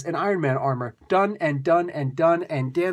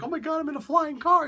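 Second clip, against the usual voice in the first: much higher, giddy squeal of delight; happy and amazed; 11 semitones higher than usual. Retold or imitated. Old man thrilled in Tony's flying car, giddy high squeal. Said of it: "And he's all happy".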